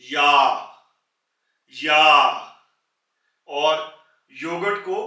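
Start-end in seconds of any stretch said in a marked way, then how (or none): 0.0-2.5 या said much louder, stretched, open vowel; German word demo. Shown as a demonstration. Teacher drilling the German word for year, loud and drawn out. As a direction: call out या as a model. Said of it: "year को कहते हैं".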